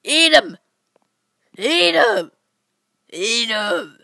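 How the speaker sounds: mock triumphant taunt; taunting shout. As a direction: rub it in. Gloating at Naruto.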